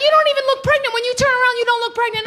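high, sugary compliment; gushing stranger. Retold or imitated. Stranger complimenting my figure, high gushing voice. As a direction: coo it like a thrilled fan. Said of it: "She's like".

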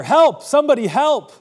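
high, loud, desperate yell; panicked child's shout for rescue; crying for help. Retold or imitated. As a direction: scream for help as if about to be attacked. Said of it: "I started to cry louder".

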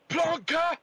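loud, high Scottish shout; Scottish accent. Pretend. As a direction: bark it like a Scottish insult. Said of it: "Scottish terms".

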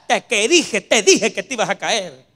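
loud, exasperated I-told-you-so; hammed-up exasperated reproach; mock scolding. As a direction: scold like a fed-up parent. Acts scolding after the fall.

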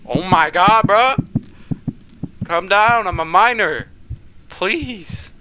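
high, loud frantic begging; pleading for mercy in a panic; panicked plea. Real, not acted. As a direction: gamer begging the monster to spare him, panicked.

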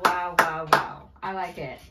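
ordinary delivery

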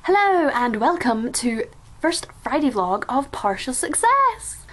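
big, bright game show host welcome. Pretend. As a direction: announce it like a TV host. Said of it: "I sound like a game show host".

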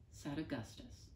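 usual speaking voice

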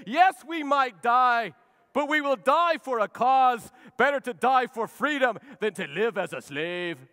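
high, loud, slow rallying cry; William Wallace battle speech. Acted. [name] as William Wallace rallying his men, loud and slow. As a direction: rouse the troops before the charge. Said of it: "William Wallace moment. Braveheart".

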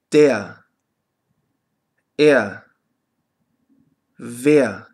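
"Der", "er", "wer" ending in a short "ah"; low, separate model words with no consonant R. Shown as a demonstration. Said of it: "noch drei Beispiele".

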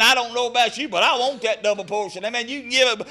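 ordinary delivery

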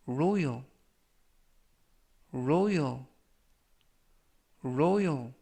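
royal said three times, clear and deliberate; pronunciation demo. Shown as a demonstration. Teacher modelling royal for students, careful. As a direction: model royal slowly for learners. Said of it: "이라고 발음하시면 되겠고요".

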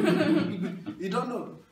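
ordinary talk, nothing marked

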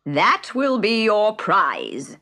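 slow, grand bird voice; Dodo voice. A narrator's reading. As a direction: hand down the prize grandly. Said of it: "said the Dodo".

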